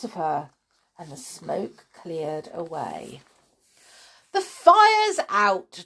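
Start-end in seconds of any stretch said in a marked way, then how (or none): 4.3-5.8 put-on high, loud boyish pride; proud announcement. A narrator's reading. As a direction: announce it triumphantly. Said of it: "George announced proudly".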